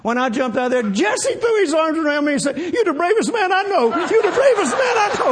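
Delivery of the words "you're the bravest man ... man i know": squealing with laughter; high laughing praise. Retold or imitated. Friend praises him, laughing high.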